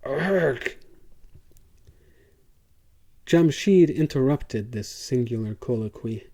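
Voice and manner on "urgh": a short strangled roar through gritted teeth; roar of frustration. A narrator's reading. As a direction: growl out the rage wordlessly. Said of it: "clenched his fists and teeth and roared".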